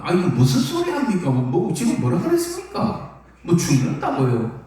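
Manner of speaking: 경상도 사투리로 항변하듯; Gyeongsang dialect protest. Retold or imitated. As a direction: argue in a Gyeongsang accent as if to stop him. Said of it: "이게 경상도 말로".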